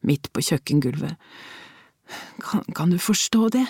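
put-on a weak old lady's near-whisper; frail sick old woman. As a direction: let each word cost her breath. Ill old woman complains faintly.

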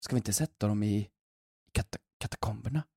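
hushed, conspiratorial and halting; imitated whisper. Receptionist whispering a secret idea to colleague, imitated whisper. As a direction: drop to a soft, secretive near-whisper. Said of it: "i katakomberna viskade de".

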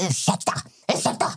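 strained, voiceless mimicry of impatient shoppers; pointed excuse-me. Older couple pushing past in the shop, imitated pointed excuse-me. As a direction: imitate someone huffing to get past. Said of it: "Och man hör och de bara".

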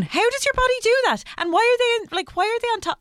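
high, loud incredulous squeal; traumatized child. Retold or imitated. Recalling the shock of opening her parents' book, high-pitched traumatized outburst. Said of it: "I was traumatized 'cause I remember opening it".

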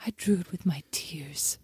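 slow, soft, breath-only whisper; milk it like a tragic little artist; dramatic child imitation. Tearful child showing Mom his drawing, imitated dramatic whisper. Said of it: "real flair for the dramatic".

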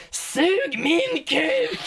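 high, slow, laughing mock yell; a hammed-up laughing scream; mock shout. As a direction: yell it at the speaker while cracking up. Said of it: "och skriker".

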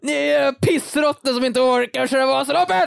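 hånfullt vrål mot publiken, ljust och högt; skriker. Pretend. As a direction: skrik ut det som en hånande åkare längs spåret. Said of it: "Man skriker". (åkare vrålar hånfullt åt åskådarna)

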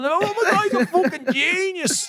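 very high, slow gushing fan through laughter; mock fan gush laughing. Des mimicking fans adoring his Dublin accent, high laughing gush. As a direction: squeal the praise, laughing. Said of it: "be like".

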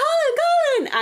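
very high mock shout; mimicked high-pitched call; imitated excited calling. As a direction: squeal the name like a fan. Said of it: "She was like".

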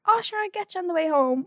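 breezy, obliging local voice; easygoing taxi man. Retold or imitated. Imitating the relaxed taxi driver Teddy, lightly voiced. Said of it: "he was like".

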